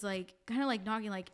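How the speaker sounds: ordinary talk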